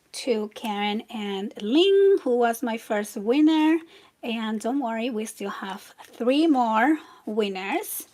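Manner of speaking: usual speaking voice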